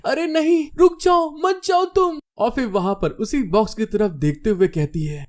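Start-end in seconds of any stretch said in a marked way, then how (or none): 0.0-2.2 very high-pitched anxious pleading; panicked. A narrator's reading. Girl begging the hero not to leave, panicked. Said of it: "घबराते हुए कहती है".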